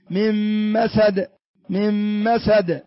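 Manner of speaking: من مسد slow, like a double meem "mim-masad"; merged noon. Shown as a demonstration. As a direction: say من مسد as two meems. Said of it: "كأنك تنطق ميمين". (كأنك تنطق ميمين ببطء)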